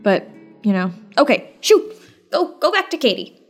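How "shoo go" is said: usual speaking voice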